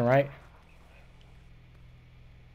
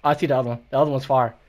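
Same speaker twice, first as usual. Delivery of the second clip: loud urgent spotting callout; excited callout; 2 semitones higher, 11 dB louder, faster than usual. Real, not acted. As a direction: call it out loud and quick. Spotting second enemy.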